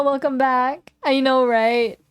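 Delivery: ordinary talk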